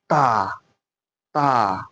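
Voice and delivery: "Ta" with the tongue tip on the front palate behind the upper teeth; Arabic ta. Shown as a demonstration. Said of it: "the tip of my tongue must touch the palate".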